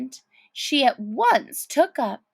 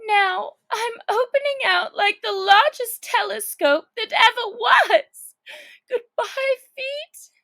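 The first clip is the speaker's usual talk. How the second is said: put-on high, excited girlish voice; a girl marvelling as she shoots upward; wide-eyed Alice calling out in wonder; Alice stretching tall; 11 semitones higher, 5 dB louder than usual. Alice's voice, giddy as she grows.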